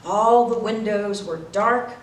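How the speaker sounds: plain reading voice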